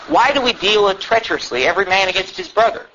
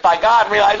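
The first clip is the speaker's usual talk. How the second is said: ordinary delivery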